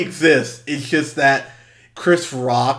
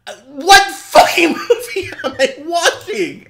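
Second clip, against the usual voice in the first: baffled disbelief; 13 semitones higher, 7 dB louder than usual. Retold or imitated. Own baffled reaction.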